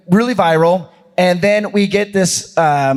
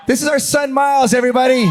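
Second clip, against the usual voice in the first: excited introduction; 7 semitones higher than usual. Real, not acted.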